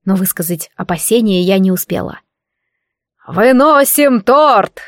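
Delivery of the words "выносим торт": drawn-out, higher-pitched proclamation; booming announcement. A narrator's reading. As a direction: proclaim it slowly like a head cook to the hall. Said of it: "громогласно объявил главный повар". (громогласное объявление)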